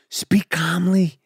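slow whispered instruction; calm whisper. Shown as a demonstration. Passenger telling crew how to announce, calm whisper.

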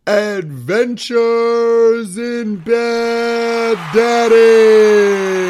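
slow, loud booming show-title call; announcer voice. Acted. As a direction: belt it out like a game show host. Announces the segment title loudly.

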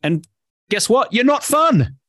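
high, exclaimed mock revelation; emphatic punchline. Real, not acted. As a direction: deliver the blunt verdict with comic punch. Punchline on being a killjoy.

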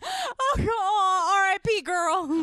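high loud mock wail, slow; hammed-up grieving cry. Mock mourning [name].

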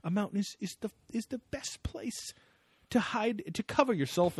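high, halting imitation voice; Tsoukalos. Host imitating the show's expert on hiding places, high and halting. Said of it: "that's what Tsoukalos talks about here".

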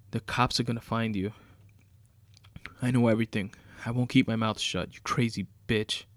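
angry shouting. Acted. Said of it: "said Charles".

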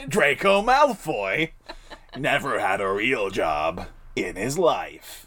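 slow, low drawled retort. Pretend. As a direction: drag it out low and smug. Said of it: "same line redone as Draco".

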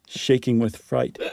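plain reading voice

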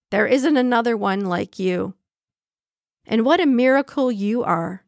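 ordinary delivery

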